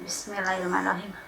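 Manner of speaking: fast, soft bismillah; quick murmured prayer. Real, not acted. Prayer before eating. (doa cepat dan pelan)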